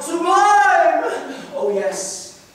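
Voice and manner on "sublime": high, loud shouted "Sublime!"; loud cry of praise. Acted. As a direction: call it out to the whole crowd. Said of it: "tell the crowd ... clear and loud".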